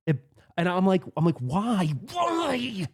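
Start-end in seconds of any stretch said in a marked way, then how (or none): 1.4-1.9 higher-pitched, drawn-out "why"; first, more contained cry of disbelief; retold dismay. Player pleading after the ball bricks off, retold dismay. 2.1-2.9 very high, stretched-out "why"; anguished wail. Retold or imitated. Player crying out to the pinball gods, anguished wail. Retold plea, escalating repeat.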